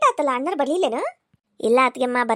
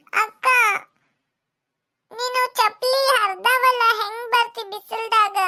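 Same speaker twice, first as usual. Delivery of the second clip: put-on much higher, slow small boy; child's voice; 7 semitones higher, slower than usual. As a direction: speak as the little brother. (ಚಿಕ್ಕ ಹುಡುಗನ ಧ್ವನಿ)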